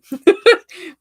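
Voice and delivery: loud, high burst of laughter. Real, not acted. Big laugh at her own plan.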